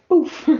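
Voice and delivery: high puffed "boof"; boof sound effect. Shown as a demonstration. Showing the comic's launch noise, high puffed boof. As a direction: pop it out like a launch into the air. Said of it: "that's the sound effect".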